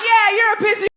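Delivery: loud, high echoed insult; backing-up shout. Retold or imitated. Daughter joining mother against a driver, acted shout. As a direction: pile on with gusto.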